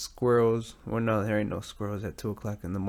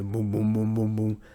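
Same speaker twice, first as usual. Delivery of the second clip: truck motor imitation. Shown as a demonstration. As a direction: imitate a sputtering truck engine with your mouth. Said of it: "it just sounds like a broken down fucking truck".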